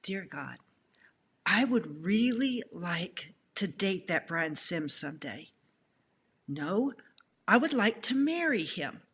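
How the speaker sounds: hushed retold prayer. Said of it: "this is what I prayed".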